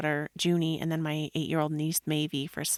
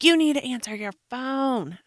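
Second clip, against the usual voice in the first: higher and louder, imitating the husband's stern order; raised voice of a husband fed up with the missing phone; stern demand; 7 semitones higher, 6 dB louder than usual. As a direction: play the husband scolding her to answer. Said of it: "And he's like".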